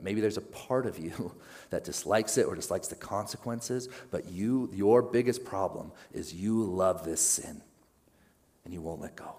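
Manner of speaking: usual speaking voice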